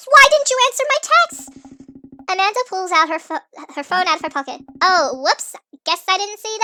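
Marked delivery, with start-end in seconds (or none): 0.1-1.5 higher, louder scolding question; scolding dad. Acted. Dad scolding his daughter for ignoring texts, loud and higher. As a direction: demand an answer from your daughter.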